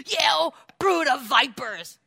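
fiery prophet. Retold or imitated. John the Baptist denouncing the Pharisees, slow fiery roar. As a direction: hurl it slowly like John breathing fire. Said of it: "and he goes".

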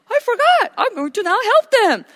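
high-pitched, loud comic realization; hammed-up startled squeal; mock sudden remembering. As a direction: pretend it just hit you, voice leaping up. Mocks idea of God forgetting.

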